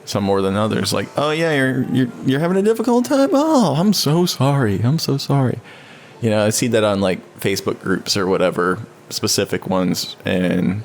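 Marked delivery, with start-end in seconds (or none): 1.1-5.6 high-pitched gushing mock sympathy; put-on pity, drawing out the sorry; mock condolences. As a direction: imitate over-the-top sympathy, pitched way up. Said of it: "the American way of saying condolences".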